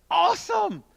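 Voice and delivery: high, loud burst of delight; thrilled exclamation. Real, not acted. Speaker recalling his big catch to the crowd, thrilled. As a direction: belt it out, pitch soaring.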